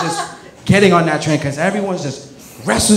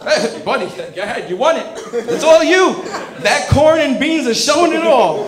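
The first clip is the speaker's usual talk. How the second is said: mock encouragement; 7 semitones higher than usual. Mock cheering a runner who soiled himself.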